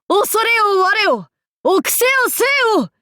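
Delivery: high, fast, fierce cry; fierce battle cry. Acted. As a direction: spit the taunt out fast and high.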